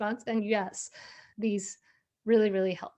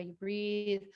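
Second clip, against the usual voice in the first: normal talking voice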